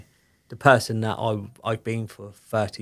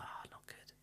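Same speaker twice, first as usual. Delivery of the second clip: barely voiced, very soft answer; whispered reply; 26 dB quieter than usual. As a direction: breathe it out with no voice. His own glum reply retold.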